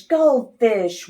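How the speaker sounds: usual speaking voice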